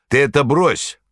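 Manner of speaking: high, loud stern rebuke; stern shout. A narrator's reading. Manager snapping at Korovyev, stern shout. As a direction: shut him down sternly. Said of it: "крикнул на это сурово".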